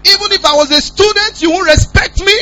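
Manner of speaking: high, loud outraged telling-off, slowing down at the end; indignant scolding. Retold or imitated. Lecturer rebuking students who wanted to fight him, high loud indignant scolding.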